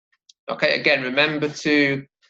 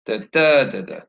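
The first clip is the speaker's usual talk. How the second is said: da-DA-da-da, falling away after the stressed "da"; stress pattern demo. Shown as a demonstration. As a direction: tap out the rhythm, hitting the second "Da". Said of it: "the word sight takes the stress".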